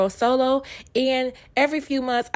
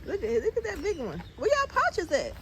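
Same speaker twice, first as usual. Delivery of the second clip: put-on much higher, sing-song baby talk; high coo to kangaroos; 8 semitones higher, 6 dB quieter than usual. Asking the kangaroos about pouches, high cooing. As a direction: coo at the joeys like babies.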